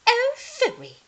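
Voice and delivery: high, loud scoffing phooey; dismissive. A narrator's reading. Cinder Hazel dismisses the witches' threat, high scoff. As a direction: brush off their threat. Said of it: "said Cinder Hazel".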